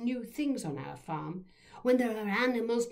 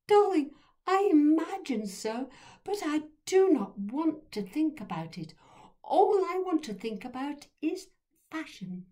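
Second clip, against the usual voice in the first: high, louder posh aunt brushing it off; 8 semitones higher, 5 dB louder than usual. A narrator's reading. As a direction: dismiss the question in a lofty posh voice. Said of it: "posh voice".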